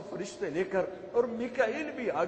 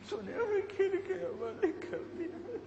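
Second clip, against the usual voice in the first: higher, softer, near tears; voice breaking; 12 semitones higher, 5 dB quieter than usual. Real, not acted. Preacher repeating his question to God, voice breaking. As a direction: let the question crack into a sob.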